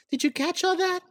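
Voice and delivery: put-on very high puzzled old lady; fussing granny at the phone; grandmother voice.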